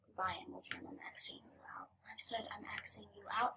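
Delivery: soft whisper to the screen. Real, not acted. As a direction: breathe it out quietly. Whispering while closing things.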